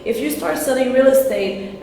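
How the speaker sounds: ordinary talk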